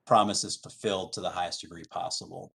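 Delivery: usual speaking voice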